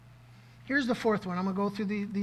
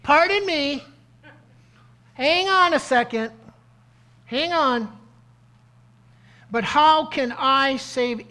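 higher and louder, a flustered objection; protesting Gideon; 5 semitones higher, 7 dB louder than usual. Retold or imitated. Gideon objecting to the angel of the Lord, high and loud. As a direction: play Gideon balking at the angel, voice jumping up loud. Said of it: "He goes".

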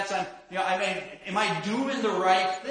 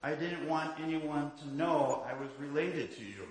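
put-on boy's sheepish answer; 5 semitones lower, 8 dB quieter than usual. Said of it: "the boy said".

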